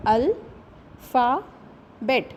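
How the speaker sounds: अल, फा, बेट as three separate beats with pauses; syllable by syllable. Shown as a demonstration. Teacher splitting an example word for students, syllable by syllable. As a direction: break alphabet into "अल … फा … बेट". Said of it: "example of three syllable".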